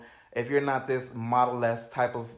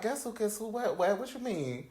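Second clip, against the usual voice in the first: confused surprise; 8 semitones higher, 6 dB quieter than usual. Retold or imitated. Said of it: "And I'm like".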